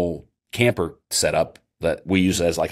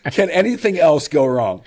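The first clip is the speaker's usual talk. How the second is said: exasperated lament; 8 semitones higher, 5 dB louder than usual. Retold or imitated. Despairing rhetorical question.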